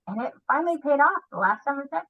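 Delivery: ordinary talk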